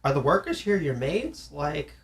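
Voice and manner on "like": ordinary delivery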